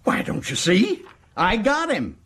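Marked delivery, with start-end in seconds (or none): 1.4-2.2 high, excited announcement; high-pitched boast, quicker first take; triumphant. Acted. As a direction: crow that you caught him.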